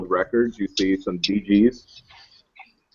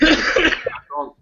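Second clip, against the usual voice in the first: put-on German accent; 12 semitones higher than usual. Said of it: "I don't know why I'm talking German now".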